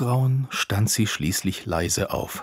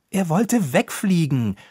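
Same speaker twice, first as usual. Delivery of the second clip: high, loud self-defence; defensive; 8 semitones higher, 5 dB louder than usual. A narrator's reading. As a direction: protest in your own defence, pitch raised. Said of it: "verteidigte sich Ropucher".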